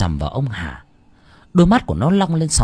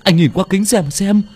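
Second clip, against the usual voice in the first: much higher, louder frantic wailing; panicked tearful pleading; 10 semitones higher, 5 dB louder than usual. A narrator's reading. Assistant begging his boss to check the body, frantic tearful wail. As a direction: beg him to get out, near hysteria. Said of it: "Thằng Tiên vừa nói, vừa mếu máo".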